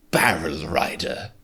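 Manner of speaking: breathy stage whisper; hushed, slow mystery whisper. Pretend. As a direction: whisper the title like a secret. Hobbit reference, whispered title.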